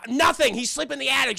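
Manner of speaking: high, loud mock irritation; hammed-up curt answer; defensive retort. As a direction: act out a defensive husband. Husband snaps back in quarrel.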